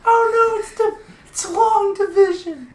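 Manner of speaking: high, loud excited yell; excited shout. Real, not acted. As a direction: shriek it out in mock panic. Loud reaction to game enemy.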